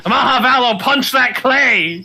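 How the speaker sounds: high, slow dramatic command; anime duelist. Pretend. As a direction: shout the attack like an anime hero. Anime-style attack command.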